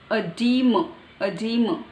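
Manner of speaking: EDEMA as "uh-DEE-muh", stress on DEE; American pronunciation. Shown as a demonstration. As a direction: say EDEMA with a weak first "uh". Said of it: "It's American".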